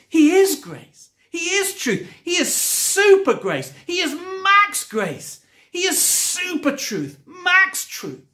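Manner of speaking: high-pitched, punchy preacher's declarations; emphatic preaching. Real, not acted. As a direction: preach it in rising, ringing bursts.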